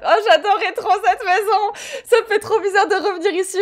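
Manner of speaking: high, laughing gush; delighted laughing. Real, not acted. Player back in a beloved house, laughing with delight. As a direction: gush it through giggles, pitched high.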